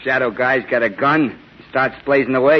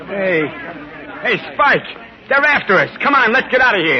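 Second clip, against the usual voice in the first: higher, urgent frightened calling; panicked; 8 semitones higher than usual. Acted. Henchman warning Spike the mob is coming, panicked. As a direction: urge him to flee now.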